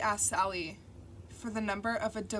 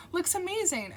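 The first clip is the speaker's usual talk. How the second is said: bright praise; 9 semitones higher, 5 dB louder than usual. A narrator's reading. Narrator voices upbeat compliment.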